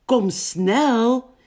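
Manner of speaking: high, loud call from a sheep; urging sheep. A narrator's reading. Schaap hurries Big toward the pond, high urging call. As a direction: call out to hurry a friend along. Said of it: "zegt Schaap".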